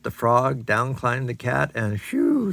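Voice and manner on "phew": high relieved sigh of phew. A narrator's reading. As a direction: let out a big relieved phew. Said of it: "said the dog".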